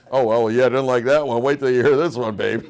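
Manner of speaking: loud mocking husband retort, in a put-on voice; hammed-up husband sparring with his wife; sarcastic husband. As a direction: throw it back at her, daring and cocky.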